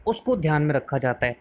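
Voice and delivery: usual speaking voice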